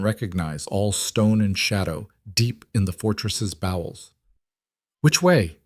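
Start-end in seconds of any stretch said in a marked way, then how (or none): 5.0-5.6 high, urgent question in a panic; frightened woman asking which way to run; panicked. A narrator's reading. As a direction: ask it as if the guards are already close. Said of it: "her voice panicked".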